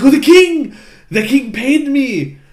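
hammed-up cat blurting who paid him; frantic Latin-accented cat. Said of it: "I kept trying to do a Latin accent".

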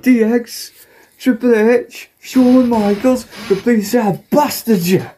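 very high comic wrestler impression; British Bulldog. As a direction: squeal out the names in a mock voice. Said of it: "to make the British Bulldog".

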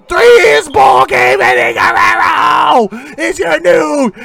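high, loud shouted ringside call; wrestling play-by-play. Shown as a demonstration. Podcaster re-calling Eddie's title win for listeners, shouting play-by-play. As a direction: call the finish like a ringside announcer, building to the three count. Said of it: "calling the match again".

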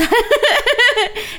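bright laughing burst; high burst of laughter. Real, not acted. Laughing about tripping over the dog.

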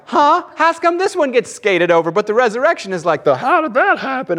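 high, loud, incredulous inner voice; baffled question. Retold or imitated. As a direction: puzzle over it out loud like a confused kid. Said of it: "I go".

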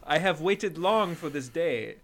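cartoon Eastern European villainess; measured, stagy Eastern European accent; mock Eastern European accent. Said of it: "Eastern European accent".

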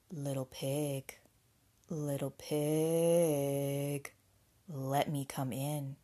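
low, drawn-out wolf call; big bad wolf. A narrator's reading. Wolf at the pig's door, low drawn-out voice. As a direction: stretch the words out long and low. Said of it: "along came a wolf".

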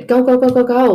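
loud, rapid-fire urging; frantic hustle. Acted. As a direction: rush everyone out the door. Acts out hustle bustle.

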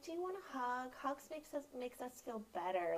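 lightly voiced little kid, high and soft; a gentle hint of a small child comforting mom; mild child voice offering a hug; child's voice.

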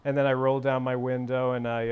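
normal talking voice

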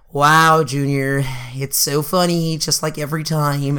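slow, low sarcastic imitation; unamused cousin. Cousin answering Junior's teasing, slow unamused sarcasm. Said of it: "and then she's like".

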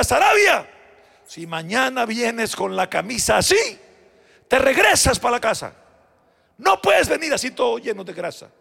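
loud, high reprimand; a teacher scolding a greasy-shirted pupil, loud. Retold or imitated. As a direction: warn the boy off sharply.

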